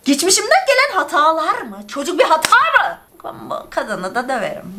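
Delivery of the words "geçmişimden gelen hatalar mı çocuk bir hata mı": outraged rhetorical questions. Real, not acted. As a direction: throw her words back at her. Outraged at letter. (öfkeyle yükselen sitem)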